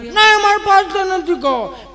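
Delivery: very high, drawn-out whine; bratty child. Acted. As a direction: whine out a spoilt refusal. Spoilt child refuses food. (જીદ્દી બાળકનો લાંબો કકળાટ)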